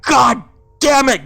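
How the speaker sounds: loud high furious curse; angry curse. Acted. Hunk cursing at his sister, furious. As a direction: spit it out in rage.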